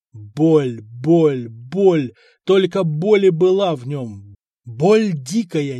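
each "боль" hit loud and heavy; anguished emphasis. A narrator's reading. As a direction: pound out "Боль, боль, боль" with pain. Narrating unbearable torture pain.

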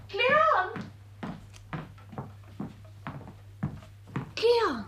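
high call across the house. Acted. Elise calls for her brother, high and ringing. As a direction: call his name from afar.

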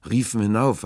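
loud, high burst; calling emphasis. A narrator's reading. Stranger recounts hailing the ship, raising his voice loudly. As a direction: lift it like a shout.

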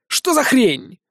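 high-pitched exasperated outburst; exasperated, pitch jumping up; angry yell. A narrator's reading. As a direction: cut in with an angry cry. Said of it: "проорал я".

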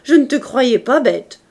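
loud, high jeering, building; mock his request at full voice; shouted scorn. A narrator's reading. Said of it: "cria Javert".

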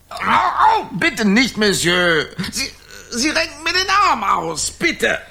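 high, loud pleading cries; pleading in pain. Acted. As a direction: beg him to stop hurting. Arm twisted, man pleads. (hohes, lautes Flehen vor Schmerz)

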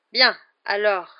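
slow, stalling restart; losing the thread. A narrator's reading. Hagrid resuming his lesson, having lost the thread. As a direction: drag it out while hunting for words. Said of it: "qui semblait avoir perdu le fil".

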